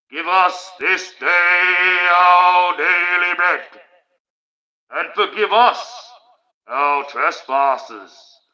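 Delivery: hammed-up ethereal prayer. Streamer hamming up the Lord's Prayer for chat, loud slow ethereal intoning. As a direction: intone it like a grand cathedral echo. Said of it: "Let's ham it right up".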